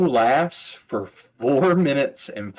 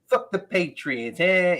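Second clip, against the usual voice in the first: rough imitation of a Boston accent, pitched up; the Bostonian; 7 semitones higher than usual. Teller badly mimicking the comic's stage voice, Boston accent. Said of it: "I'm probably doing his voice worse".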